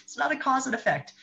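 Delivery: high, sudden catching of a mistake; mock realization; self-correcting. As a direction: catch yourself mid-sentence. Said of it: "I go".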